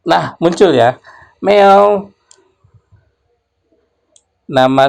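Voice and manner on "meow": high, louder playful meow; cat meow. Pretend. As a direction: mew like a cat from the program. Cat sound from program output. (meongan kucing yang tinggi dan ceria)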